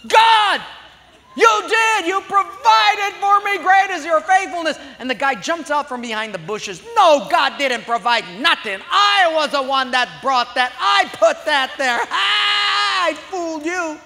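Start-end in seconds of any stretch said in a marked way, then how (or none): 0.1-4.8 very high, loud joyful cry; joyful shout. Acted. Lady finding groceries, shouting praise to God. 7.0-14.0 high, loud, taunting shout; gloating neighbor. Acted. Atheist neighbor leaping from bushes to gloat.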